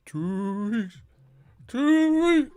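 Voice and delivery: very high, glitching repeat; malfunctioning robot woman. Retold or imitated. Mimicking the Total Recall disguise, very high glitch. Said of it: "kept saying".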